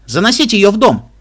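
loud, high, urgent order; urgent gabble. A narrator's reading. Doctor to unexpected guests at the door, urgent gabble. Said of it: "протараторил он".

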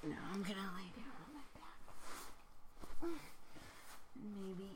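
whispering. Real, not acted. Whispered narration.

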